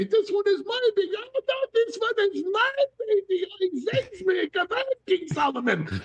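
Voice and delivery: put-on high, whiny quarrel over the baby; squabbling women. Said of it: "the two women bring in the baby and they say".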